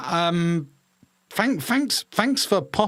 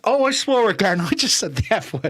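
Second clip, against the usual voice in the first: Kermit the Frog voice; 7 semitones higher, 4 dB louder than usual. Shown as a demonstration. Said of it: "I was doing Kermit the Frog".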